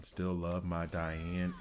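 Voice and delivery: slow, drawn-out Southern drawl repeating the line; mocking own Southern accent, slow; exaggerated Southern accent. Shown as a demonstration. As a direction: stretch each word in a Southern accent. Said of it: "is so Southern".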